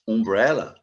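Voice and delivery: "Umbrella" with a plain U vowel, no Y; model pronunciation. Shown as a demonstration. Teacher contrasts "umbrella" for students, pure U. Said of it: "o U tem som de U realmente".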